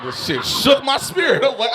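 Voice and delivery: ordinary talk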